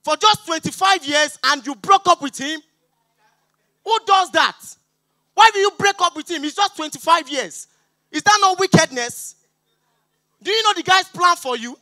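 hammed-up fury at the friend; mock angry. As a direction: scold her for leaving. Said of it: "I was angry".

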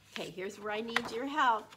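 ordinary delivery, nothing marked

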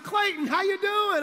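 high, loud cheerful hello; friendly surprise at running into him; old teammate greeting. Retold or imitated. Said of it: "He's like".

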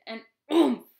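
loud impact grunt; loud oomph. Acted. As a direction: bang out the crash.

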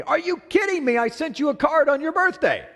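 indignant, pitched high in protest; mock-offended husband brushing off his wife; defensive retort. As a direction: act affronted, like he already did enough.

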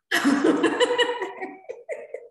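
high burst of real laughter; amused laugh. Real, not acted. Host laughs at evaluator's startling question.

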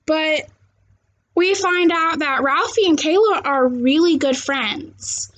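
ordinary delivery

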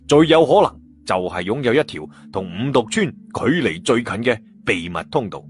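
plain reading voice

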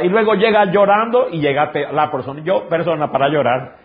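normal talking voice

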